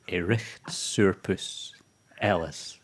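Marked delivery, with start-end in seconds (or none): 0.1-1.7 "Erichtsurpus" said slowly in broad Scots; slow Scots "Erichtsurpus", with a throaty ch; Scottish phrase. Shown as a demonstration. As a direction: present the Scots phrase "Erichtsurpus", each part drawn out. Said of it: "Scottish word of the week".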